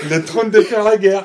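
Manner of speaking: laughing through the words. Real, not acted. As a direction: say it while cracking up. Laughing at the title joke.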